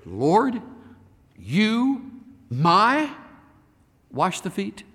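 Peter's shocked question. Retold or imitated. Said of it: "And Simon said to him".

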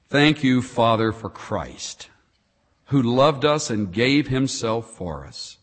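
hushed, reverent prayer voice. Acted. Pastor leading the congregation in prayer, hushed and reverent. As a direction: pray slowly and sincerely.

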